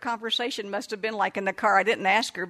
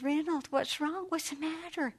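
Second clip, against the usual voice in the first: hushed, slow, higher tender asking; soft and low-key; 7 semitones higher, 7 dB quieter than usual. Retold or imitated. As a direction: kneel down and coax him softly. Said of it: "I got down low, you know, and I talked to him really".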